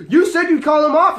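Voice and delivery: high, loud pleading accusation; mock desperate complaint; imitated panicked protest. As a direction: protest like someone cornered. Imitates his panicked protest about cops.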